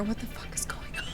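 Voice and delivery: shocked whisper; half-voiced, breath-only disbelief; stunned whisper. Real, not acted.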